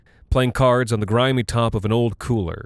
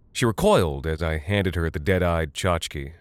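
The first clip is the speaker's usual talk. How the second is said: plain reading voice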